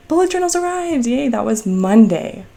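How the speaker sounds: high, bright burst of joy; thrilled announcement of the delivery; excited. Real, not acted. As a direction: squeal it like happy news. Celebrating the delivery day.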